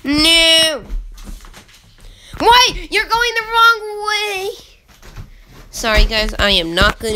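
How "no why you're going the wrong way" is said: high, loud, drawn-out gamer wail; dismayed wail. Real, not acted. Player yelling as it goes the wrong way, dismayed wail. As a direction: wail in protest, stretching the last words slowly.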